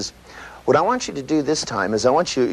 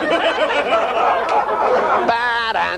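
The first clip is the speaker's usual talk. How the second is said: slow, higher vocal horn fanfare; horn section imitation; 9 semitones higher, much slower than usual. Shown as a demonstration. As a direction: blare it like a brass section. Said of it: "that's when the horn section comes in".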